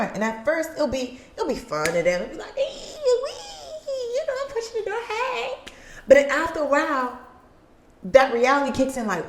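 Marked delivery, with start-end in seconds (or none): 2.6-5.5 put-on squealing high kid voice, giggling; gleeful kid. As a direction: play a giddy little kid at the door. Kid playing with revolving door.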